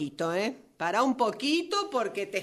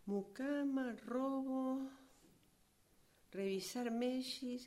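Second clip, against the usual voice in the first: muttering to herself; 2 semitones higher, 13 dB quieter than usual. Acted. Author listing revisions to herself, muttering absently. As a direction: murmur each item as if jotting it down.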